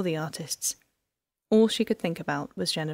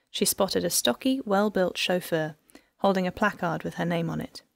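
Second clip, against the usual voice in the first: usual speaking voice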